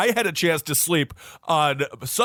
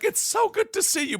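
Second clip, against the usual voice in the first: imitating a bawling fan. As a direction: squeak it out like a crying fan. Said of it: "she was just bawling".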